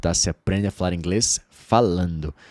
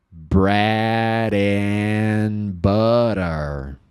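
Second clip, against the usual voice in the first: "What's your bread and butter?" very slow, "watchor brad en butter"; slow model; much slower than usual. Shown as a demonstration. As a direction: model it slowly for repeating. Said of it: "speak aloud". ("What's your bread and butter?" bem devagar para repetir)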